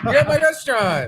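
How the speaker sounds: mock greeter voice.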